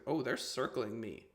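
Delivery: normal talking voice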